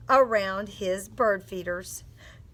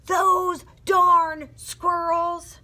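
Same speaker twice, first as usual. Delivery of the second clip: put-on loud, higher, drawn-out old man's yell; yelled in story; 7 semitones higher, 6 dB louder, much slower than usual. A narrator's reading. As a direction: yell it out slowly, each word stretched. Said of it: "and yelled".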